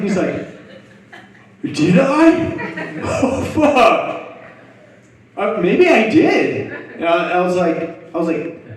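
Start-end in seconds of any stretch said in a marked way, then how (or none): 1.6-6.7 very high, incredulous imitation; high-pitched mock dismay breaking into laughter; dawning realization. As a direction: squeal it like a baffled, tripping friend. Friend realizes he wet himself.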